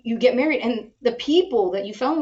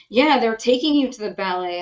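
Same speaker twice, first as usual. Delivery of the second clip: ordinary talk, nothing marked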